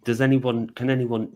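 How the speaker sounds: ordinary talk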